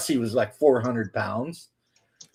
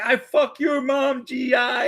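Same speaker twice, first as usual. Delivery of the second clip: high, slow mock shouted taunt; imitating a distant yelled curse; 10 semitones higher, slower than usual. Enemy soldier taunting troops from a distance, mock shout. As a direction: holler it from across the jungle. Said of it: "Vietnamese guy yelling curse words".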